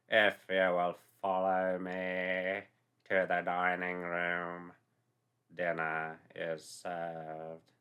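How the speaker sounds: slow, low butler announcement; solemn butler. A narrator's reading. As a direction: announce dinner gravely, very slowly. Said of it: "they them announced".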